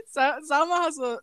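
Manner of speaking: breaking into giggles mid-name; cracking up while telling the story; laughing. Real, not acted. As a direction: laugh through the words.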